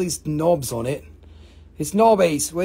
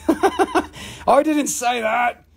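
laughing; 6 semitones higher, much slower than usual. Real, not acted. Laughs denying his joke.

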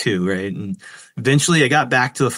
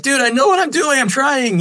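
panicked retort. Retold or imitated. His own panicked reply.

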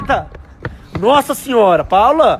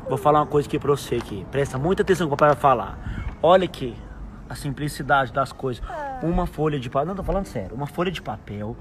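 softer, fast-paced confiding talk; nostalgic lecture; 6 semitones lower, 8 dB quieter than usual. Acted. Papa telling his daughter how simple things were, soft and quick. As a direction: lean in and share an old lesson.

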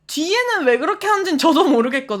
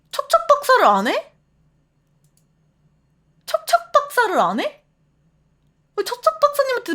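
mock outrage; 10 semitones higher than usual. Outraged at a viewer's version.

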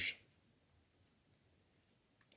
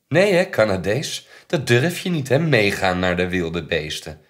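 high, loud jeer dropping back in pitch; loud taunt; 3 semitones higher, 12 dB louder than usual. Acted. Taunting the Canadees about the wild beasts, loud jeering. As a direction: taunt him at full voice.

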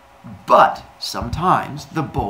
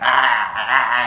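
louder grunting ah ah ah while eating; homeless Fred's grunts; 6 dB louder, much slower than usual. Pretend. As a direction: grunt like a man gobbling food. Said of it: "homeless Fred's like".

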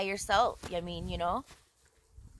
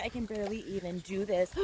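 ordinary talk, nothing marked

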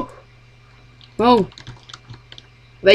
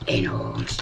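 usual speaking voice

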